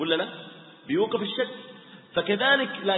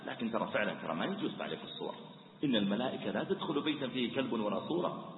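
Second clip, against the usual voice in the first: much softer, lower, quick and kindly; gentle advice; 6 semitones lower, 9 dB quieter than usual. Shown as a demonstration. Preacher showing the gentle advice to the calmed man, soft and kind. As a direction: slip the advice in quietly. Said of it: "قل له بعد ذلك".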